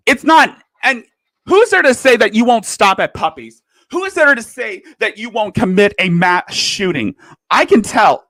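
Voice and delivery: loud, high, accusing questions; heated questioning. Real, not acted. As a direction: demand answers, worked up. Angry rant at a YouTuber.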